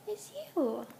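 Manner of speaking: normal talking voice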